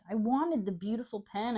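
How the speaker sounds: usual speaking voice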